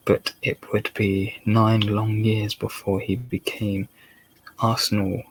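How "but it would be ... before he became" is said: plain reading voice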